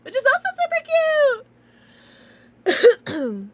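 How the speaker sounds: very high, loud cutesy squeal; gushing squeal. Real, not acted. Streamer showing off the box it comes in, gushing squeal. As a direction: squeal over how adorable it is.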